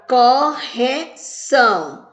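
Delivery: slow, split into cor, re, ção; syllable by syllable. Shown as a demonstration. Teacher counting syllables for children, "correção" broken syllable by syllable. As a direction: say "correção" in three separate, drawn-out beats. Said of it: "Falei quantas vezes?".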